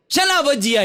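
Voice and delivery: as if the word makes no sense; surprised question. Acted. Wife surprised at bhajiya. (ઊંચા અવાજે નવાઈથી)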